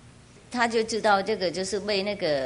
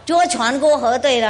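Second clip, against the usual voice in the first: ordinary delivery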